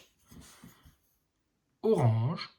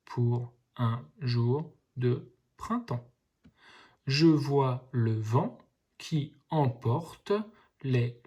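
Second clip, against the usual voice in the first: slow, careful reading split by syllables; slow first reading for young readers; slower than usual. Shown as a demonstration. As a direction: read it slowly, one syllable at a time. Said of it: "Je relis un petit peu plus vite".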